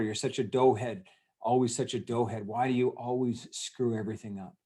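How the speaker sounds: ordinary delivery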